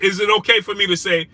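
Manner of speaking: fired-up streamer shouting at his chat; shouted challenge. Real, not acted. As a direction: throw the question at the chat.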